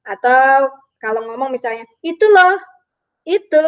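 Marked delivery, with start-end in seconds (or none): 2.0-3.6 "Itu loh", raised and loud, pause, then "itu"; demonstrated pause. Shown as a demonstration. Teacher showing pupils a pause in speech, halting. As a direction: leave a hanging gap between "Itu loh" and the second "itu". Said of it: "itu namanya jeda".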